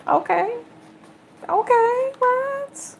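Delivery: squeaky rising amazement; rising surprise. Real, not acted. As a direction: voice climbs way up in disbelief. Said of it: "card surprises her".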